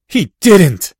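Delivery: loud, high cry of disbelief; torn out of him. A narrator's reading. Boy shocked by his book, loud wrenched cry. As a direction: blurt it as a wrenched outcry. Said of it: "Harry said in the same voice".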